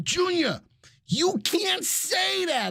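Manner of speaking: high, drawn-out mock scolding; imitated scandalized dad. Dad reprimanding his son over French, high mock outrage. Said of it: "my dad's face turned red".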